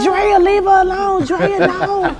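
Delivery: high, loud wailing protest; pleading auntie. Retold or imitated. Auntie on the phone to mother, frantic pleading. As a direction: imitate an auntie frantically begging her to stop.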